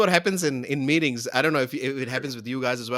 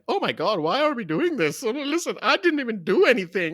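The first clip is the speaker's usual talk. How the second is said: mock whiny complainers; 11 semitones higher than usual. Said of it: "they were like".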